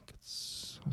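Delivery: a hushed whispered swear; barely voiced curse. Real, not acted. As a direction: drop the curse under your breath. Reaction to being fired.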